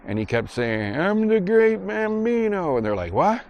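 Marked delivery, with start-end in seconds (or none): 1.0-2.8 high, slow, muffled boast; drawn-out high-pitched mumbled brag; mouth-full movie kid. Retold or imitated. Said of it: "takes it out of his mouth". 3.1-3.4 high questioning yelp; high, mock-confused "what"; baffled. Retold baffled boys asking again.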